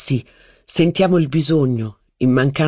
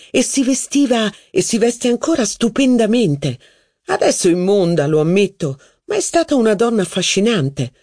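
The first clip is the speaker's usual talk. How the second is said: higher, lively society duchess; a duchess holding court, higher; haughty duchess; 5 semitones higher than usual. Acted. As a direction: gossip grandly about her cousin's wife. Duchess voiced in reading.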